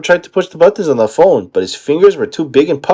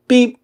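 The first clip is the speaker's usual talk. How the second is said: beep; 10 semitones higher than usual. Acted. Patrick fakes answering machine.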